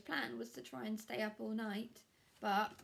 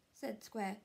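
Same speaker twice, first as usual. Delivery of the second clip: plain reading voice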